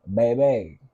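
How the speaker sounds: ordinary delivery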